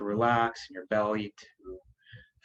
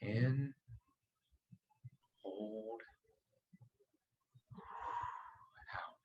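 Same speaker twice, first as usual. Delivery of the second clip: very soft single words, widely spaced; hushed breath cues; 15 dB quieter, much slower than usual. Real, not acted. Doctor pacing listeners' breathing in meditation, hushed. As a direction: let each word sink almost into the breath.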